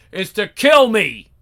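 yelled defiant dare; angry shout. Acted. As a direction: dare her to do it. Brother challenges sister to fight.

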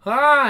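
loud, oddly performed moan; acted moan. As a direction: let out a sudden mock moan. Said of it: "That's a weird way to moan".

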